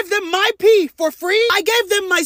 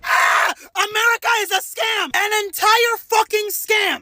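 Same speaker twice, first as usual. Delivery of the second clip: shouted, higher outrage; outraged rant; 4 semitones higher than usual. Real, not acted. To the audience, ranting about America as a shouted outrage. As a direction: yell it like a betrayal. Ranting after doctor bill.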